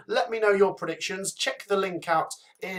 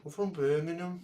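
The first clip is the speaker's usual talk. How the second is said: softer put-on Birmingham accent; Brummy accent; 5 dB quieter than usual. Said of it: "Brummy accents".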